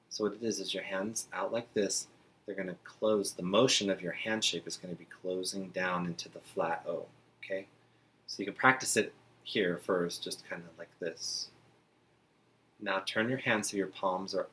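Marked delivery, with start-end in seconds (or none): none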